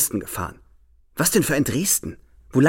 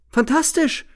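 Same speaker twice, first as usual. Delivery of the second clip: much higher, rising cry of joy; exulting; 13 semitones higher, slower than usual. A narrator's reading. Narrator exulting at her cutting word, jubilant. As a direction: crow it with delight. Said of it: "jauchze ich".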